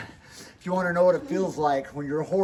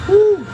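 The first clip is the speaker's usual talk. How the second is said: sharp relieved exhale; 7 dB louder than usual. Real, not acted. Exhaling after icy plunge.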